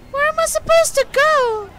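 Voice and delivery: high frantic question; lost and desperate; panicked cry. Real, not acted.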